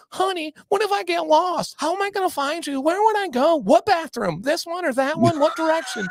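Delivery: high, panicky helpless-husband voice; mock a clingy husband firing anxious questions; whiny little punk. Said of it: "such a little punk".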